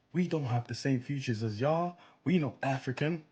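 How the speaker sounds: lightly voiced mock commenter; mocking quote. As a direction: parody the denial in a put-on voice.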